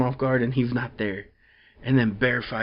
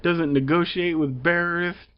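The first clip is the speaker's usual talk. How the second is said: higher, mock grave government voice; official's statement; 6 semitones higher than usual. An official explains the president's stance, mock serious and higher. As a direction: play it like a stern official on TV. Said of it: "it's like".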